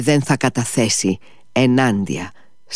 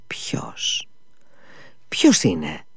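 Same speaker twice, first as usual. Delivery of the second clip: whispered question; 11 semitones higher, 6 dB quieter, much slower than usual. A narrator's reading. Ivan startled by the hint.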